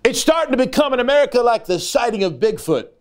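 high, fervent preaching; preaching with fire. Acted. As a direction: build the sermon to a fervent pitch. Preaching at full force.

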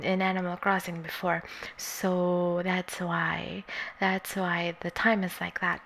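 ordinary delivery

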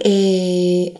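long, stalling "ehhh"; drawn-out "eh" filler. Shown as a demonstration. Teacher shows the filler to avoid, a dragged-out eh. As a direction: drag out an open hesitation vowel. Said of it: "ما تقعدش تقول".

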